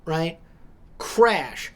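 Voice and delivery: ordinary delivery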